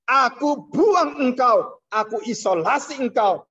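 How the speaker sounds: God warning mankind, booming slow proclamation; God's stern warning. Acted. Preacher voices God's warning.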